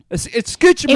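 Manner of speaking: very high, loud overdone Spanish; hammed-up Spanish call; dad's mock Spanish. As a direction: yell it like a tourist in bad Spanish. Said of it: "That's my dad at a".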